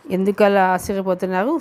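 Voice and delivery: ordinary delivery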